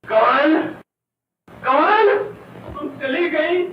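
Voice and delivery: high, loud, desperate wailing; anguished cry. Acted. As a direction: cry out for the woman who left. Deserted man cries out for Kamal. (दर्द से चीख़कर पुकारना)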